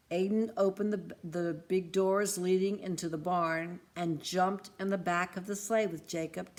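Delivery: ordinary delivery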